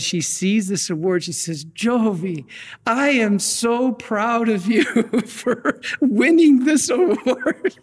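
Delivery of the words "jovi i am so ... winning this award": high, slow, proud mother's voice through a laugh; doting Italian mama. Retold or imitated. His mom from Italy praising [name] for his award, beaming and slow. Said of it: "She speaks broken English".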